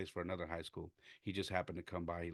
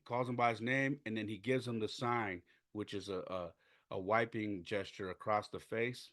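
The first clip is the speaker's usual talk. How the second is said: normal talking voice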